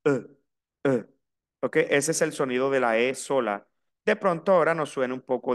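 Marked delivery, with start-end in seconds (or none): 0.0-1.0 dopey drawn-out "euh", then two short ones; mock a silly mistake with a dumb "euh"; imitating a classroom jeer on a dull vowel; schoolkids' mocking "eh". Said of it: "alguien hacía alguna bobada".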